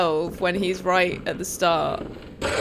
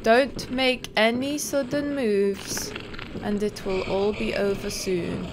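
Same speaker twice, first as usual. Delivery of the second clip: normal talking voice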